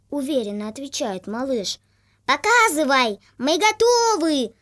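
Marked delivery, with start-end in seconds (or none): 2.3-4.5 loud, high cackling geese; honking bossy goose. A narrator's reading. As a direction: honk it out eagerly. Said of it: "загоготали гуси".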